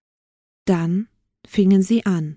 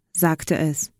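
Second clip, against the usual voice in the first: usual speaking voice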